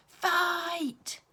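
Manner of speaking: sudden high shout of fight; high excited cry of Fight. A narrator's reading. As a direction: yell it out to the whole playground. Child calls out in playground fight.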